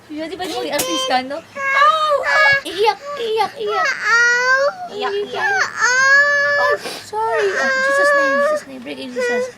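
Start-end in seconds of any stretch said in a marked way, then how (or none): 1.4-2.6 high loud fake yelp; mock ouch. Pretend pain to make the child act. 2.6-9.5 high fake sobbing voice; mock crying. Hammed-up crying to cue the child's acting.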